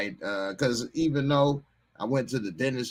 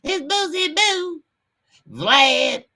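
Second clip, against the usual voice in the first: much higher squeaky [name] voice; high-pitched [name]; 12 semitones higher, 4 dB louder, slower than usual. Retold or imitated. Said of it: "his voice be getting all high pitched".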